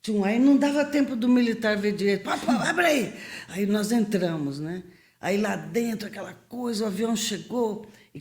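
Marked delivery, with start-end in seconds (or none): 2.2-3.1 higher, pressing call to open up; mock urgent shout at the gate. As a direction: hurry them to open the gate. Knocking, urging gate open.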